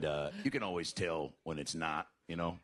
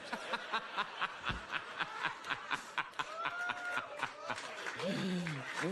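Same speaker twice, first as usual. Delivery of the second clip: high long cackle. Real, not acted. Long laugh after punchline.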